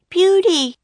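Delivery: high, loud call to a camel; Annie crying out. A narrator's reading. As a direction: cry out the name, pitched high. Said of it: "cried Annie".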